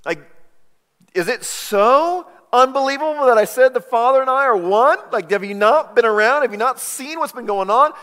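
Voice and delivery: high, loud exasperated protest; Jesus confronting his accusers; indignant. Acted. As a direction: demand why they'd stone him.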